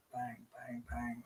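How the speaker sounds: very quiet, low little bangs; mock the blows under your breath; soft imitated banging. Streamer imagining smacking the stone, very soft imitated banging.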